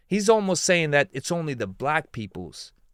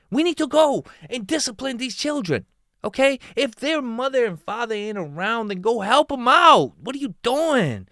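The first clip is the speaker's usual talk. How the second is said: higher, louder fired-up lecture; heated rant; 8 semitones higher, 4 dB louder than usual. Real, not acted. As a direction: scold him like he's in the room.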